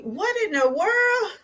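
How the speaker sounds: high, slow astonished question; imitating her own shocked reaction; mock disbelief. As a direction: act out stunned disbelief. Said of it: "I'm like".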